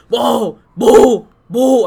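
loud, high ghost "boo"; jump-scare shouts, one after another; mock ghost. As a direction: play a ghost yelling to startle. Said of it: "estar el pinche fantasma".

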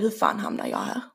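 low, soft inner-thought mutter; quiet, low-pitched private disbelief; thinking to oneself. Retold or imitated. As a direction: say it under your breath, to yourself. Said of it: "jag tänker på mig, för mig själv".

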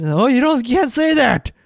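mock the easily offended kids, nagging; mocking whiny kids. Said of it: "most kids".

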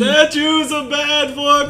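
put-on high, loud, slow old man yell. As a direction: bellow like a frail old man. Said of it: "your old man impression again".

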